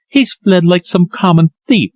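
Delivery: mildly choked, a hint of a sob; understated tearful outburst; choking sob. A narrator's reading. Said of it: "she cried with a choking sob".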